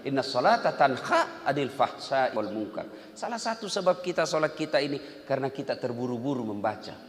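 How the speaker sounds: ordinary talk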